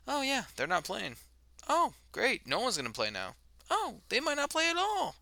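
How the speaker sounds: mock whiny voice. Mimicking news reaction.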